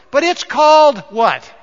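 rising from loud question to a shouted WORK; preacher's rising cry; building to a shout. Real, not acted. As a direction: climb and land hard on the word Work. Preacher building to the key word.

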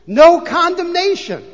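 NO condemnation, high, loud and slow; emphatic repeat. Acted. Preacher driving the verse home to the congregation, loud and slow.

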